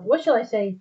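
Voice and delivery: plain reading voice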